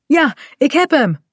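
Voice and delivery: high cry of relief; relieved call. A narrator's reading. Kaat has grabbed her fallen dog, calling out relieved. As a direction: call out that the danger is over. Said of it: "roept ze dan opgelucht".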